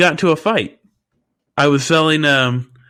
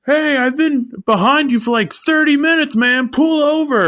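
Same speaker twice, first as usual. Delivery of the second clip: high mock yell of an angry driver; hammed-up yelling at a stuck driver; angry camper yelling. Man stuck behind him yells at ice seller, high mock yell. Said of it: "the guy starts yelling at me like".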